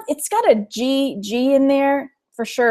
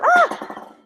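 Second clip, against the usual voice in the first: sudden high startled yelp; startled cry. Real, not acted. Vlogger startled by a spill, high yelp. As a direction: yelp as the bottle tips.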